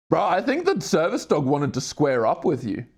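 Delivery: ordinary talk, nothing marked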